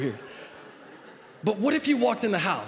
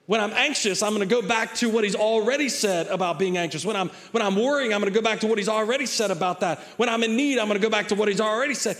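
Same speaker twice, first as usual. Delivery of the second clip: louder, higher preacher's build; preaching; 4 semitones higher, 3 dB louder than usual. Real, not acted. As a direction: drive it home to the congregation. Preaching at force.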